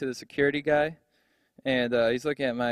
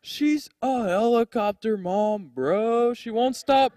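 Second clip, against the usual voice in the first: whiny bro; 6 semitones higher, much slower than usual. Retold or imitated. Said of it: "He's like".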